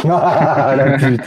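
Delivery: mock insult said through a laugh. Real, not acted. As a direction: laugh while saying it. Laughs at the assistant's reply. (en riant)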